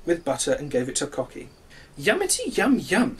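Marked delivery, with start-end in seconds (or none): 2.0-3.2 high, relishing yum sounds; happy rooster. Acted. Cocky enjoys the toast from the sun, high and delighted. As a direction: savour the buttered toast. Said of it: "said Cocky".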